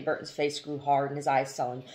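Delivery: ordinary delivery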